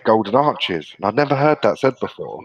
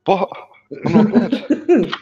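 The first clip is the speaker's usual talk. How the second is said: high, slow squeak of relief; relieved disbelief; 13 semitones higher, 5 dB louder, much slower than usual. Retold or imitated. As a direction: stretch it out high in shocked relief. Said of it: "And I'm like".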